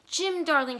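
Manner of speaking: high, loud plea; crying out. A narrator's reading. Della crying out to her husband, loud and high. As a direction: call his name in a rush of feeling. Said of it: "she cried".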